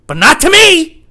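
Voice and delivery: high, drawn-out indignant yell; protesting shout. Acted. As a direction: burst out loudly in slow, high protest. Comic outburst, mocked person protesting.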